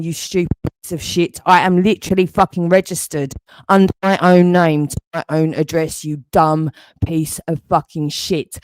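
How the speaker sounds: ordinary delivery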